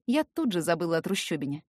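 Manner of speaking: usual speaking voice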